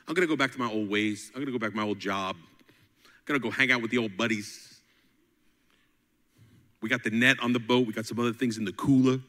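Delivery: fast, low, offhand grumble; Peter giving up. Retold or imitated. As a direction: rattle off his excuses quickly. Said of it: "Peter was like".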